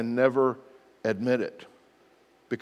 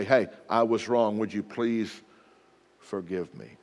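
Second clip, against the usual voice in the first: softer, fading down to a hush; soft apology; 5 semitones lower, 5 dB quieter than usual. Shown as a demonstration. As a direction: say sorry gently, letting it fade.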